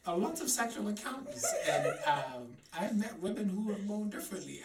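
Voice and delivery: ordinary delivery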